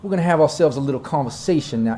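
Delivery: lightly voiced Dixie drawl; an easy Southern gentleman claiming his turf. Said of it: "my southern drawl got a little bit of that Dixie charm".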